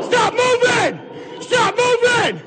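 ordinary delivery

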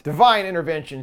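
normal talking voice

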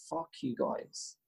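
slow, near-whispered curse; muttered resentment. Retold or imitated. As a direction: curse them under your breath, drawn out. Friend's private resentment at guards.

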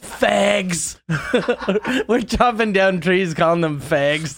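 high, laughing mock insult; mock taunt. Loggers taunting trees, high laughing jeer.

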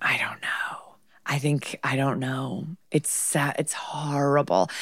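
low embarrassed whisper. Acted. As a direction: whisper it sheepishly.

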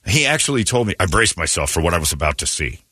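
usual speaking voice

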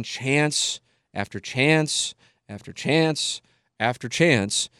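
each chance drawn out slowly; slowed emphasis. Real, not acted. Fed-up host stressing San Diego's repeated chances, slowly. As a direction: lean hard on every chance, slowly.

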